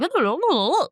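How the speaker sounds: high, sing-song babbled gibberish; fast-talk gibberish. Retold or imitated. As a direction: imitate incomprehensible fast talkers with wobbly babble. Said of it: "they talk so fast".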